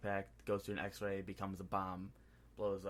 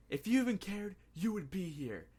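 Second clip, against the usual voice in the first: higher, louder hurt outburst; raised hurt voice, imitating Peter; mock angry retort; 10 semitones higher, 5 dB louder than usual. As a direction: fling it back like a wounded kid. Peter's angry retort, acted quote.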